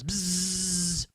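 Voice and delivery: long, level bzzz; imitated buzz. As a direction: draw out a buzzing z. Radio station name buzzed out.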